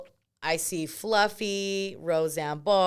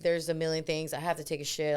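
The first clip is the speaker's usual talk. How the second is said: ordinary talk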